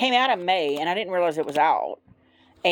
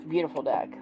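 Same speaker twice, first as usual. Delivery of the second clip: ordinary talk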